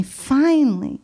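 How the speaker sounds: normal talking voice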